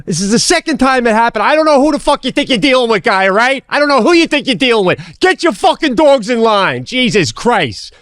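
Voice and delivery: high-pitched, furious rant; retold angry tirade. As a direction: tear into the dog owner. Retold tirade at dog owner.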